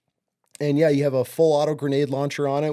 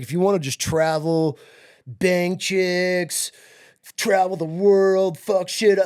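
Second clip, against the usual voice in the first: louder, higher, slow hype; hyped sales pitch; 4 semitones higher, 4 dB louder, slower than usual. Retold or imitated. Recruiter selling travel and chicks to the boyfriend, hyped pitch. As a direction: pump up every perk like a salesman. Recruiter listing perks excitedly.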